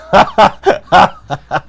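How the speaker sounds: put-on high, loud drunken cackle; drunken laugh. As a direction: laugh like a sloshed heckler.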